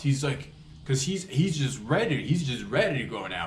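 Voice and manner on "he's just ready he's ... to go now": ordinary delivery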